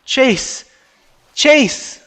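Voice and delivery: high, very loud yell of a name; screaming. Acted. Friend screams for Chase in the sinking car, screaming. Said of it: "sounds of sloshing water and screaming".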